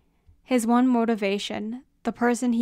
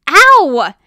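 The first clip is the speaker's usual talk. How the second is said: loud, high "ow"; sharp pained shout; yelp of pain; 6 semitones higher, 16 dB louder than usual. Acted. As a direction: cry out as if stomped on. Foot gets stomped on.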